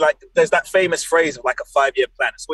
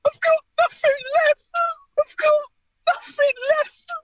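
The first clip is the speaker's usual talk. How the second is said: high-pitched, whimpering fake sob; mock crying impression. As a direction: blubber it in a squeaky, hammed-up Essex voice. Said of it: "he'll just cry in his arms saying".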